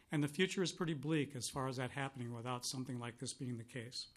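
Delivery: ordinary talk, nothing marked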